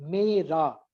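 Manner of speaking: two syllables slightly drawn apart; syllable split. Shown as a demonstration. Teacher showing a class where the sound breaks, syllable split. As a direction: say मेरा as मे, रा. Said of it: "आवाज कहीं नहीं टूटी".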